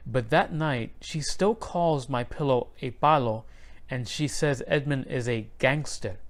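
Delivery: usual speaking voice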